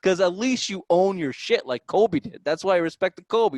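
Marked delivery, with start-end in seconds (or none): none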